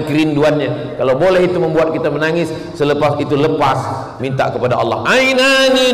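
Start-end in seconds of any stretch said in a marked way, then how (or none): 5.1-5.9 Arabic hadith recitation. Acted.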